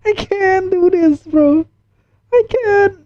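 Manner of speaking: high, panicky protest; frightened gamer backing out; real fright. Real, not acted. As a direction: voice jumps up in real fear. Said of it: "I'm getting goosebumps again".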